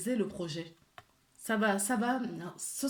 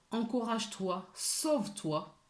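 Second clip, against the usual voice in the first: ordinary talk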